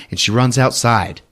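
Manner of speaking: ordinary delivery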